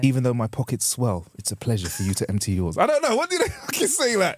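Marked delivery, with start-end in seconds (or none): 0.0-2.8 low, measured mock period speech; lightly put-on romantic period-drama line; Bridgerton suitor. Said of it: "trying to think of a Bridgerton way".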